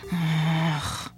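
a fed-up sigh of a word; mildly exasperated. A narrator's reading. Nota gives up on the beeping foil, exasperated. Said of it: "machte Nota entnervt".

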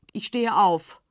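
plain reading voice

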